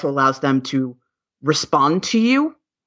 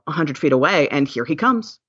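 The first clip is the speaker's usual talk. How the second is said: ordinary delivery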